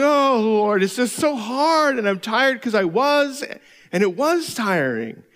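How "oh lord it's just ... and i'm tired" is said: drawn-out complaining to the Lord, higher; whiny prayer. Retold or imitated. Pastor mocking his own prayers to God, whiny. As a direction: whine it like a tired kid. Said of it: "became very whiny".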